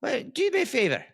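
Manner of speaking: high Scottish shopkeeper; Scottish accent. Acted. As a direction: coax the customer in a Scots accent. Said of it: "do you do a Scottish accent".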